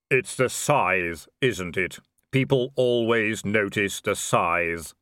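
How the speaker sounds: drawn-out reaper musing; grim reaper voice. Acted. Death speaking.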